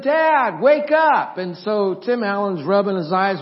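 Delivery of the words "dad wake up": high, loud call to wake someone up; excited kid yelling for his father; boy calling dad. Retold or imitated. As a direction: shout it high and urgent, acting out a child. Son voiced waking dad.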